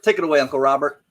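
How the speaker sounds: ordinary talk, nothing marked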